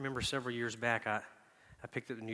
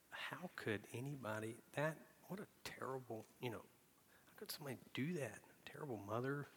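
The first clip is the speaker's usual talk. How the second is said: softer, breathy scorn sinking to a whisper; hushed judgment; 2 semitones higher, 10 dB quieter than usual. Retold or imitated. Preacher recalling judging a single mom, hushed. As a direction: mutter your condemnation under your breath. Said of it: "I was like".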